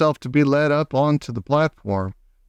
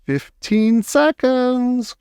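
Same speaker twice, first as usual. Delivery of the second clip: very slow, higher witch count; witch crying out; 10 semitones higher, much slower than usual. Acted. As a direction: stretch the seconds out. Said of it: "cried the Grand High Witch".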